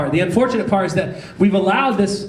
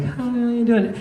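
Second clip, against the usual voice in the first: higher-pitched polite greeting; feigned friendly hello; mock politeness; 5 semitones higher than usual. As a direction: greet politely, a little too sweetly. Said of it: "waving back politely at her".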